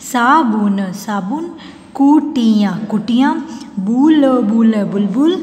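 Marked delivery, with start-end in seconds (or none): 0.0-0.9 साबुन stretched sound by sound; syllable by syllable. Shown as a demonstration. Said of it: "फिर ये सारे बोलने". 1.9-2.7 कुटिया in held, separate syllables. Shown as a demonstration. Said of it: "फिर ये सारे बोलने". 3.8-4.7 बुल, बुल drawn out in parts; syllable by syllable. Shown as a demonstration. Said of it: "फिर ये सारे बोलने".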